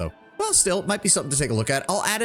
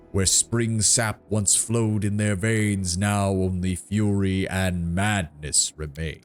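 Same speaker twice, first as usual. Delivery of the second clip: slow, solemn tree giant; treant voice; much slower than usual. Acted. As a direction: draw each word out like an ancient tree. Treant's oration.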